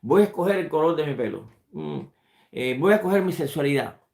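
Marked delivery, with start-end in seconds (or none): none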